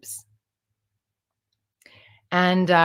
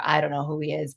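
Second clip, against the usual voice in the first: normal talking voice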